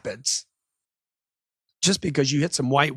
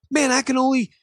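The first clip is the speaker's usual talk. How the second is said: higher-pitched protesting customer; acted customer complaint, pitched up; upset customer; 9 semitones higher than usual. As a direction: object like a frustrated buyer. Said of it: "the guy tells you".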